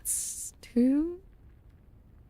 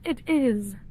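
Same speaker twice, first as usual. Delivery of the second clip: usual speaking voice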